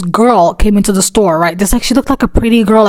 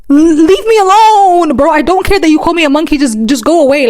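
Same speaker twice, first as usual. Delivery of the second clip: acted exasperation; 6 semitones higher than usual. Worker begging an apologizing customer to go, exasperated. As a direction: plead to be left alone, pitched up high.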